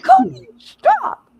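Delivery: high, loud, slow mock exasperation; hammed-up scandalized plea; exasperated husband. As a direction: wail it like a fed-up husband. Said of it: "He's like".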